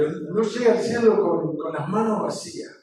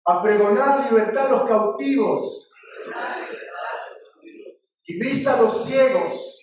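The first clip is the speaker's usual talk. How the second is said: raised, loud preacher's declamation; scripture recitation; 5 semitones higher, 3 dB louder than usual. Acted. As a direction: proclaim it phrase by phrase for the congregation to repeat.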